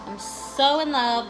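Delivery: normal talking voice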